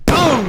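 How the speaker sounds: sharp high mock gunshot "boom"; mouth gunshot. Gun backfires, mock gunshot.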